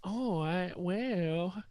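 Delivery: ordinary talk, nothing marked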